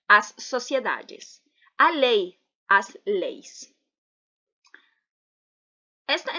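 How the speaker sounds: usual speaking voice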